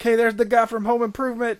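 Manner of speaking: high, loud mock-delighted spotting; surprised recognition. In the theater, spotting a familiar actor, mock surprise. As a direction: spot a familiar face, delighted.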